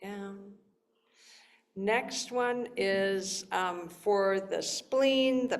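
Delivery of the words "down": quiet. Shown as a demonstration. Soft cue during exercise.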